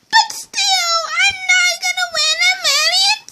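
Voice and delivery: high whining cry fading to a whisper; mock wail. Child fake-cries about not winning a million bucks.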